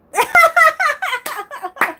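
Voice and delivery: very high, loud cackle; real loud laugh. Real, not acted. Laughing at the kiddie gesture, loud high cackle. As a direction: let out a long staccato burst of laughter.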